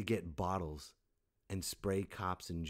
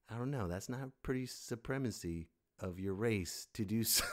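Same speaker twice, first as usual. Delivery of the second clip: ordinary delivery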